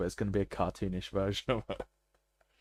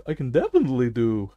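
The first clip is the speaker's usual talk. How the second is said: ordinary talk, nothing marked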